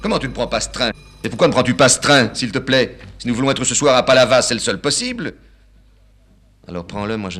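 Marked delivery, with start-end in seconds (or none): 0.0-5.3 loud, high, outraged father; father scolding his son for refusing the train, loud and high; indignant old father. Acted. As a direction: blow up at the son.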